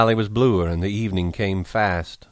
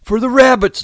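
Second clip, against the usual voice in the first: high, loud joyful shout; 9 dB louder than usual. Acted. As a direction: burst out with glee. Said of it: "Lenny shouted".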